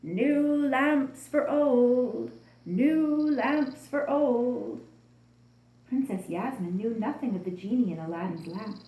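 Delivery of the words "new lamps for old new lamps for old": slow, loud hawker's call; drawn-out street seller's cry. A narrator's reading. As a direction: sing out the wares slowly to the street. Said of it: "He called out".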